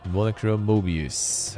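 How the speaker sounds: ordinary delivery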